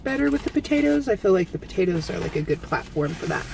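eating taco between words; talking while chewing food. Real, not acted. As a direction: mouth partly full. Seen 3: takes taco bites at 0.2 and 3.6, talks between bites.

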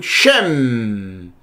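"Shem" low, ending in a long hummed m; closed-mouth final M. Shown as a demonstration. Teacher showing the letter mem, lips shut on a long m. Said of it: "com a boca fechada".

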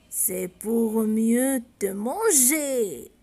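very slow, low wolf threat; wolf reveals himself to the girl, menacing. Acted. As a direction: savour each word before the pounce.

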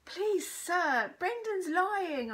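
high, loud, drawn-out protest; crying out. A narrator's reading. Pupil defends new boy to teacher, crying out. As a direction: call out urgently, stretching the words. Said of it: "I cried out".

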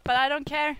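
even robot voice. Real, not acted. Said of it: "I sound like a fucking robot".

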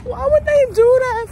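real tearful wail; wailing cry. Real, not acted. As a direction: let it burst out as a sobbing wail. Crying over the movie ending.

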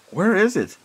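high, startled question; alarmed. Acted. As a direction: ask it in sudden alarm. Narrator finds doorway gone.

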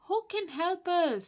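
high, loud plea for help; frightened villagers. Acted. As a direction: cry out in panic.

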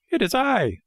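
very high little puppet voice; high-pitched puppet question; Pinocchio. A narrator's reading. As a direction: ask as a small, eager marionette. Puppet answers the snail.

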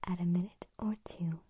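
loud stage whisper, every consonant crisp; crisp whispered tongue twister. Shown as a demonstration. ASMR host whispering a tongue twister to viewers, crisp and loud. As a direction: whisper it hard, spitting out each t.

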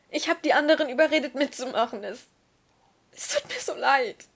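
pleading, voice climbing high and loud; desperate confession. Acted. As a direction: pour out guilt near tears. Tearful confession to Manu.

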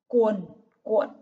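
"Cuồn cuộn" word by word, full uôn in both; loud, separated "Cuồn cuộn", careful uôn rhyme; clear rhyme uôn. Shown as a demonstration. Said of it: "phát âm đúng vần uôn".

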